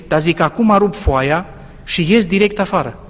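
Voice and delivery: ordinary talk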